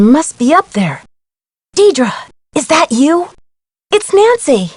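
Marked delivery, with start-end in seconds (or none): 1.8-4.7 tentative call, rising higher. Acted. Nancy checking whether Deidre is up there, calling out. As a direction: call out to someone who may be hidden. Calls to someone unseen upstairs.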